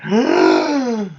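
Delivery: long, high intake of breath; acted gasp of alarm; shocked gasp. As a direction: suck the air in sharply, drawn out. His shock at the deadline.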